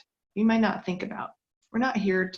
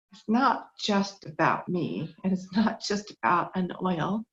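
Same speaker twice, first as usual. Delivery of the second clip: ordinary delivery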